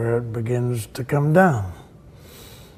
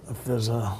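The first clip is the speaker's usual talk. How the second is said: ordinary delivery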